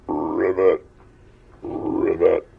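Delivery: loud croaking ribbit; an old frog calling from the stream. Acted.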